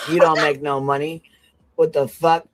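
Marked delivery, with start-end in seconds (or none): none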